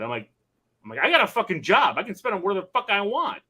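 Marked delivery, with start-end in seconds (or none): 1.0-3.4 raised, higher retold snap; mock indignant retort. Dad defending his spending to his kids, indignant. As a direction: throw it back at the kids.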